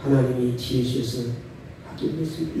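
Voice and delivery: humble soft demo. Shown as a demonstration. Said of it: "이렇게 말해요".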